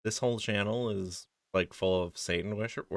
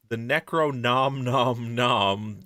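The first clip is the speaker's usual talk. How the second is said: slow; 2 semitones higher, much slower than usual. Acted. Reading product title slowly.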